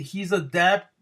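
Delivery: usual speaking voice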